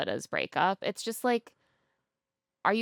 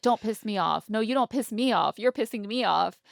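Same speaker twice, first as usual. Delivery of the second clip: higher, louder heated squabble; mock bickering; 5 semitones higher, 5 dB louder than usual. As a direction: snipe back and forth, mock angry. Acted couple bickering.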